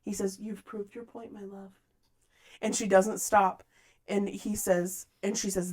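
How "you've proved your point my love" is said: very soft, calm reply; hushed, composed tenderness. Retold or imitated. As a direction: say it gently and much quieter, unruffled. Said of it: "he so calmly, he says".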